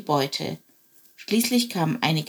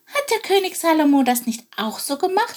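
worried sparrow voice; 9 semitones higher, 3 dB louder than usual. Acted. Chilp asks anxiously.

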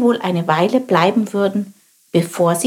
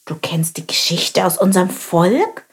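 ordinary delivery, nothing marked

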